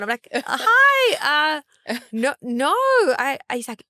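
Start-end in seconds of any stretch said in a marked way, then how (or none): 0.3-2.7 1 flustered squeal. Retold or imitated. Flustered when recognized.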